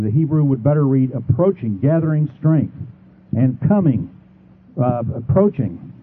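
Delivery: normal talking voice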